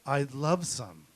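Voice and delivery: slow, dreamy reply; slow savoring. Retold or imitated. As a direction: draw it out, savoring it. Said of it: "I was like".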